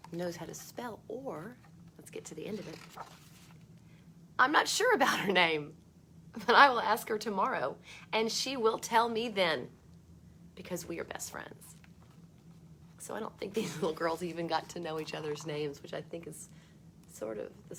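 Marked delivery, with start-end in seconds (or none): 4.4-11.8 put-on bright read-aloud voice for children; storybook reading. A narrator's reading. Teacher reading a book to kids, lively read-aloud.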